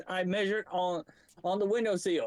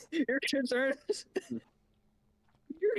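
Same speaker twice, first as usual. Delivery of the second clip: amused, words broken by laughter; laughing while talking; 3 semitones higher, much slower than usual. Real, not acted. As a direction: laugh through the line, incredulous at a friend. Laughing at teammate's dinner question.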